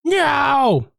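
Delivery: high cry of no; hammed-up high protest; mock shout. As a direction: yell it high and sudden. Shouted at murder.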